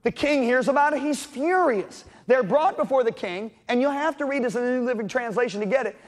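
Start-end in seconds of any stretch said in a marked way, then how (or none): none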